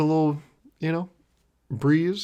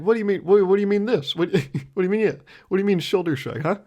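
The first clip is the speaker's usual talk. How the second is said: incredulous questioning; 6 semitones higher than usual. Retold or imitated.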